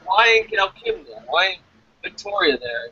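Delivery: normal talking voice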